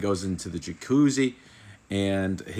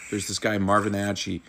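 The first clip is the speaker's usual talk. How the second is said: ordinary talk, nothing marked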